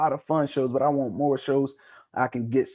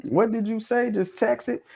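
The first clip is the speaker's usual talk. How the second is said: much higher, teasing puzzled question; mock confusion; 10 semitones higher than usual. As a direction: play up not understanding a word. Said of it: "look at Timbo mouth when he talk like".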